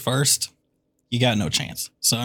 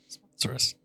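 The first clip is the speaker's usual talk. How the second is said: breathy whispered sponsor plea; 7 dB quieter than usual. Pretend. Host begging Liquid Death to sponsor, whispered plea. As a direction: hush it like a secret request.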